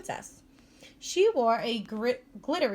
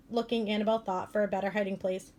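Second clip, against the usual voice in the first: plain reading voice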